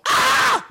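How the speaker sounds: very high mock shriek; imitated scream. Dad imitating the other boy in the car, mock shriek. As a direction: squeal "Ah" like a panicked boy. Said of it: "so they're screaming like".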